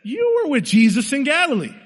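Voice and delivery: high-pitched accuser calling Peter out; acted outburst from an accuser in the crowd; heated accusation. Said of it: "when the tempers are at the highest they could be".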